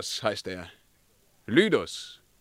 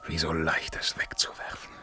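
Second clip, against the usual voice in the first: whispered self-doubt, lower; brooding whisper; 5 semitones lower, 7 dB quieter than usual. Acted. Emperor alone doubts his power, low whisper. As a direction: murmur it as a secret to yourself.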